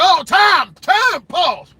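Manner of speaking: panicked stop-the-scene shout. Retold or imitated. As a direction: yell for the hoax to stop. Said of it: "I'd have been like".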